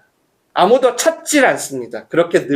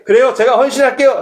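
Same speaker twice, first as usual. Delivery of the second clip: higher, brighter eager "I'll do it"; mock eager volunteer; 7 semitones higher than usual. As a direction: play an overeager yes. (신나서 자원하는 흉내)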